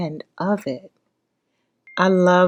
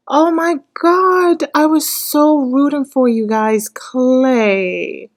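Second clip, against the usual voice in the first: higher, slower, drawn-out wail; wailing lament; 7 semitones higher, 4 dB louder, slower than usual. Real, not acted. Viewer repeating the letdown to the couple as a slow high wail. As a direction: stretch it out like a heartbroken whine.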